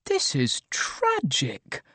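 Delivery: slow, drawn-out mild distress; faintly weepy, stretched-out lament; slightly teary. A narrator's reading. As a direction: lament it slowly, a little tearful. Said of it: "had a slightly teary look".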